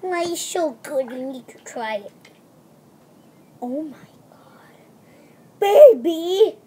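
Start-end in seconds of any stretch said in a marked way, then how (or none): 3.6-4.4 whispered oh my God. Pretend. Pretend shocked aside. 5.6-6.6 put-on loud, higher call to the baby. Mommy calls out to doll.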